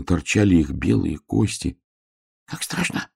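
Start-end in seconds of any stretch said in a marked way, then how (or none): 2.1-3.1 high, hushed hedgehog whisper; soft, whispered little hedgehog voice; frightened whisper. A narrator's reading. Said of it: "шептал ёжик".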